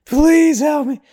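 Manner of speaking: high, mock-panicked plea; pleading for help in a high, loud mock-panic. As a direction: play the girl losing her mind, begging. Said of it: "losing her mind".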